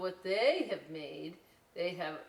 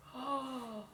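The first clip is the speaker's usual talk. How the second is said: mock gasp; 6 semitones higher than usual. Surprised gasp at craft.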